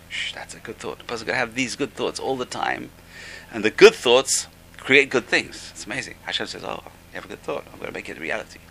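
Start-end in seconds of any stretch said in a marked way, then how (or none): 0.1-1.0 1 hushed shush. Real, not acted. Hushing aside while teaching. 6.6-8.6 1 soft voice of God. Acted. Voicing God's reply softly.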